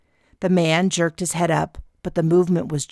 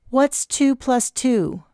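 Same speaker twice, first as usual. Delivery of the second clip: slow, deliberate higher question; slow and careful; 8 semitones higher, slower than usual. A narrator's reading. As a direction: spell it out slowly. Aunt tests for concussion.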